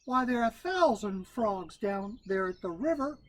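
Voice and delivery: Brer Rabbit voice. A narrator's reading. Rabbit answering.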